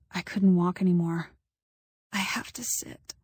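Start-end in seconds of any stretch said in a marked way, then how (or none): 2.1-3.1 weak, slow croaked whisper; croaking whisper. A narrator's reading. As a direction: croak it out, barely voiced. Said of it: "I croaked to Amiel".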